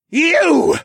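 loud, high furious shout; furious bellow. A narrator's reading. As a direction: bellow it with rage. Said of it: "A furious bellow".

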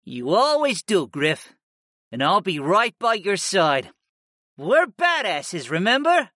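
high, loyal creature sidekick voice; pep up your master as his beast companion; high-pitched, affectionate rallying; encouraging cat familiar. Acted.

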